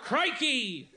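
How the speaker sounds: high, drawn-out Australian exclamations; mock Australian accent. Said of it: "working on my Australian accent".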